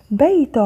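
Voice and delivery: بيت read with an added -un ending; tanween damm. Shown as a demonstration. Teacher reading out the word list, tanween damm on بيت. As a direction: sound out بيت as "baytun". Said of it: "ضم".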